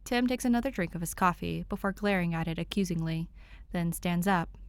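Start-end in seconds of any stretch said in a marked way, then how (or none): none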